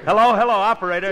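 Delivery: high, loud and fast calling; excited call. Real, not acted. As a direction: shout eagerly for the operator. Excited phone call to operator.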